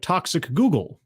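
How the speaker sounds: "Toxic Google" run together as one quick phrase; words run together. Shown as a demonstration. Host showing viewers the pun, running "Toxic Google" together. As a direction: blur the gaps so it sounds like "Toxic Google". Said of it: "if you say it fast enough".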